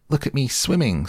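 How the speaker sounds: high, gleeful little squeak; tiny excited voice, pitched right up; squeaky Roo. A narrator's reading. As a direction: show off in a high child's squeak. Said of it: "squeaked Roo".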